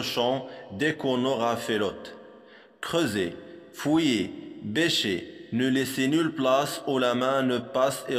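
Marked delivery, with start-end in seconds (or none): none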